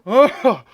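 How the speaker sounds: loud high groan of embarrassment; real cringing wail; anguished groan. Real, not acted. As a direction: groan like you want to disappear. Mortified at his slip.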